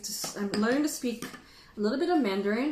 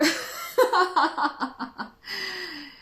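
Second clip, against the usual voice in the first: loud, high cackling laugh; big laugh; 10 semitones higher, 4 dB louder than usual. Real, not acted. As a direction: burst out laughing, trailing off. Laughs at her own warming joke.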